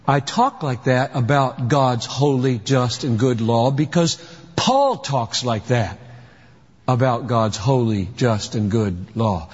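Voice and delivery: low, even reply to the objection; measured answer. Real, not acted. Calm answer to the voiced objection.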